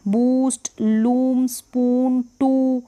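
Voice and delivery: "बूस्ट, लूम, स्पून, टू" with drawn-out oo; long oo. Shown as a demonstration. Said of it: "ऊ का उच्चारण लंबा होगा".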